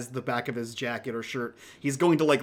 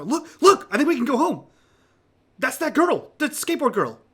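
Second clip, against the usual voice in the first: high, breathless-with-news excitement; high-pitched excited character voice; excited calling out; 10 semitones higher, 6 dB louder than usual. Acted. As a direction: point her out to your friends, urgently. Mitch points out the skateboard girl.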